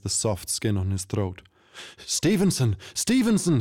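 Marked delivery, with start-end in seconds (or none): 2.0-3.6 much higher, frantic blurting; terrified tavern owner blurting the name; panicked stutter. A narrator's reading. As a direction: spit the name out in terror. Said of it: "he stuttered".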